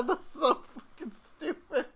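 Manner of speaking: high, loud laughing; wheezing through the words. Real, not acted. Cracking up after joke.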